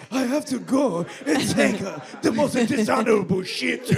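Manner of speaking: halting put-on Japanese accent; mock Japanese accent. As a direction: imitate a Japanese man declaring it gravely. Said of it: "we were doing, like, Japanese".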